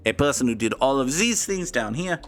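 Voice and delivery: comic put-on French accent; terrible French accent. Streamer naming his grid answer in a terrible French accent. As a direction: keep the bad French accent going. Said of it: "[name]'s terrible French accent".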